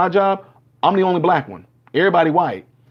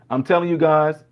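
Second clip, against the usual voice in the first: ordinary talk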